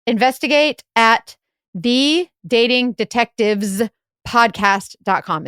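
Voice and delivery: spelling out the address slowly; deliberate, slow announcing; careful slow recital. Shown as a demonstration. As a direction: read it slowly like a test answer. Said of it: "That was so good".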